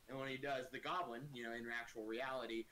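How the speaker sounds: normal talking voice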